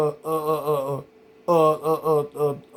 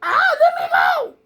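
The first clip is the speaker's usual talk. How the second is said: high, squealing mock holler, much higher; hollering little dude; much slower than usual. The little inmate squealing as his face is squeezed. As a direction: squeal it high like a scrawny guy being grabbed. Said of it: "He go to holler".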